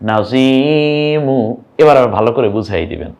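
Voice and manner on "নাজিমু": নাজিমু drawn out slowly, each syllable held long; slow careful reading. Shown as a demonstration. Teacher correcting a rushed reading, very slow. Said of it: "একটু ভুল হবে".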